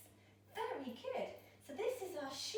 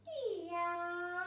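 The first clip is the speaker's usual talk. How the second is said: stretched-out meow; cat meow; 2 semitones higher than usual. Shown as a demonstration. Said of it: "we might go".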